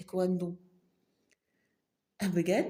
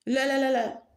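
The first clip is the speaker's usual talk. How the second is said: panicked refusal; 5 semitones higher, 5 dB louder than usual. Acted.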